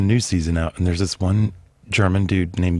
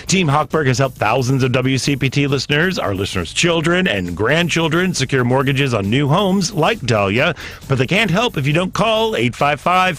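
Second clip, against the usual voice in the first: upbeat, pitched-up host ad read; radio ad read; 6 semitones higher than usual. Acted. Host reading a sponsor ad to radio listeners, upbeat. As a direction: sell the lender to listeners with energy.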